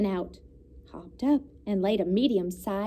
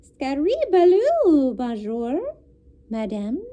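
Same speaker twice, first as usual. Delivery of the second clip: higher, slow duckling voice with French greeting; baby duck greeting; 4 semitones higher, 6 dB louder, slower than usual. A narrator's reading. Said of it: "looked up at Jack and said".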